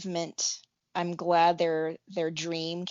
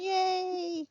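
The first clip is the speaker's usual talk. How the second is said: excited cheer; 14 semitones higher, 4 dB quieter than usual. Real, not acted. Group cheer after message.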